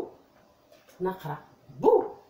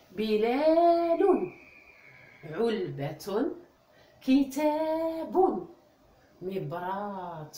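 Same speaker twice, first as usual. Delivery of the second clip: each word stretched slowly, بلال and كتاب louder; slow model reading; 4 dB louder, much slower than usual. Shown as a demonstration. Teacher reads the board words to the children, slow and clear. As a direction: read each word slowly for the class to copy. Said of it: "لاحظوا الآن".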